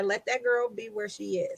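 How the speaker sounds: 笑いながら小声で; laughing. Real, not acted.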